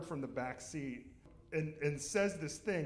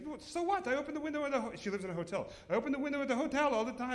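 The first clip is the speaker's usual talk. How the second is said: mother-in-law voice; 10 semitones higher, faster than usual. Retold or imitated. Mother-in-law whiny, high.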